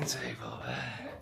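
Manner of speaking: soft whisper of amazement; whispered awe. Real, not acted. Whispering to Maria in awe at the table. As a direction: hush it out in wonder.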